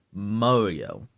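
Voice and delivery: MARIO said as MOR-ee-oh; correct pronunciation. Shown as a demonstration. Citing how the games say it, MOR-ee-oh pronunciation. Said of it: "anything that had voice lines".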